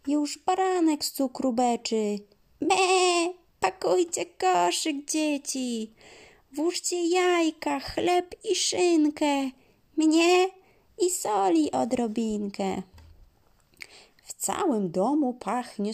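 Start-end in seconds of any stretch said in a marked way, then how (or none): 2.6-12.8 high, loud little lamb voice; bleating lamb. Acted.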